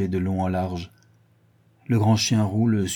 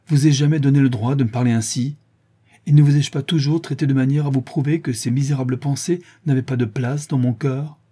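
raised, higher voice, wounded protest; indignant reproach; 5 semitones higher, 4 dB louder than usual. A narrator's reading. Hurt Berthe reproaches Jean for doubting her, indignant. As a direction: reproach him with mounting insistence.

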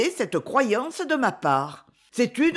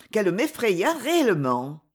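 plain reading voice

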